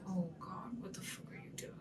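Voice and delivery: whispered disbelief at the ring; hushed dismay. Retold or imitated. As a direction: breathe out the alarm under your breath. Reacting to Paul in the ring.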